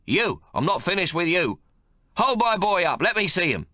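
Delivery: put-on high, loud, bossy old grandfather; gruff domineering old man. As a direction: bark orders at the new mother. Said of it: "he demands".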